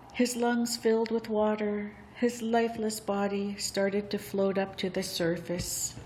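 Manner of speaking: ordinary delivery, nothing marked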